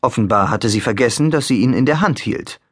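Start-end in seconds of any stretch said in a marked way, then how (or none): none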